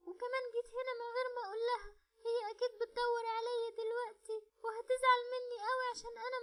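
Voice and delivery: put-on high, fast little girl's voice full of worry; a child rushing through her guilt about leaving home; regretful little girl. (بنت صغيرة قلقانة وندمانة، صوت عالي وسريع)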